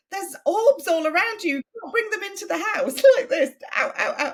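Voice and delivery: high, loud imitation of alarm, laugh breaking in; mock panic. Host warning guest about orbs, mock panic. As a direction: exclaim like a comically frantic host.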